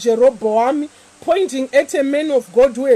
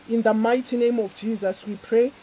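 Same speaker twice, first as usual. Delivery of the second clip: normal talking voice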